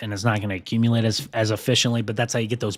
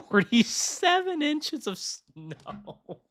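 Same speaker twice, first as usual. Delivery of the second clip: laughing; much slower than usual. Real, not acted. Laughs at absurd forecast.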